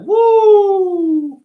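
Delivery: high, loud mocking whoop at the door banging. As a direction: let out a sarcastic high woo. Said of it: "And I'm like".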